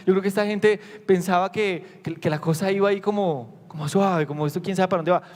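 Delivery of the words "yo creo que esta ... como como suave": ordinary talk, nothing marked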